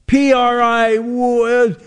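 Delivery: high, drawn-out mock astonishment; hammed-up wailing over a tricky puzzle; mock dismay. Host mocks a misspelled school poster, hammed-up dismay.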